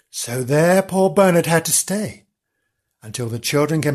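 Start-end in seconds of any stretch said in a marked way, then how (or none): none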